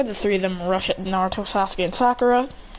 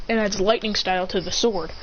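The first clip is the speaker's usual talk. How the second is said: usual speaking voice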